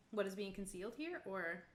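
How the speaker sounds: ordinary delivery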